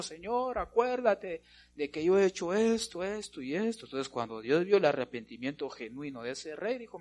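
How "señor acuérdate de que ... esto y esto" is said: high, pleading voice of a weeping king, only lightly acted; a king begging the Lord, a touch of tears. Preacher voices the repentant king, high mock pleading. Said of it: "se puso a llorar y dijo".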